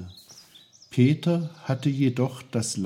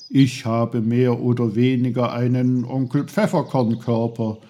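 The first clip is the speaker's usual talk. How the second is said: loud, emphatic lecturing uncle; self-important Uncle Pfefferkorn; 3 semitones higher, 6 dB louder than usual. Acted. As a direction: explain proudly with forceful emphasis.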